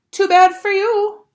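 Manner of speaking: high, loud, slow gloating; smug taunt. A narrator's reading. Sheila refusing to leave the boys' rocks, smug and loud. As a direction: rub it in like she owns the place. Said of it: "Sheila said".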